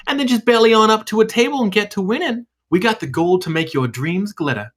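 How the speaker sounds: high, smooth hostess pitch; sultry casino hostess. Acted. Hostess welcoming the guests to the casino. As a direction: sell the casino like a slick hostess. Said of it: "flicks her tail and goes".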